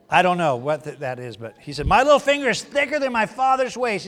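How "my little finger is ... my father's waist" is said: boastful king. Retold or imitated. Preacher voicing arrogant young king.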